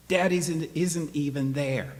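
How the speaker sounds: put-on a boy raising his voice at his mother; boy's outburst. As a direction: blurt it out as an upset boy. Boy objects in retold quarrel.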